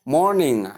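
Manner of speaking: morning as MOR-ning-uh, ng sounded out; clear ng ending. Shown as a demonstration. Teacher introducing word number four, clear ng ending. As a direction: say "morning" with a released nga at the end. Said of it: "nga at the ending".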